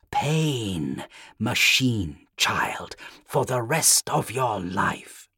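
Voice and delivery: slow, breathy demonic whisper; whispery telepathic voice. A narrator's reading. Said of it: "a voice in his head".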